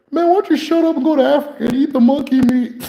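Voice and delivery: imitating the patient's insult. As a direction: spit it out high, half laughing.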